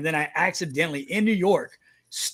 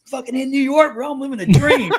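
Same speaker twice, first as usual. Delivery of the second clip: higher, louder exuberant comeback; mock-giddy retort; 7 semitones higher, 8 dB louder than usual. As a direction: crow it with a laugh, replaying the moment. Acts out his giddy reply to security.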